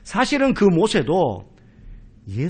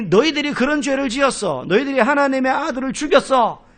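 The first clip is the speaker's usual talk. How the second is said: rebuking shout; 8 semitones higher, 4 dB louder than usual. Retold or imitated. 스데반의 꾸짖음.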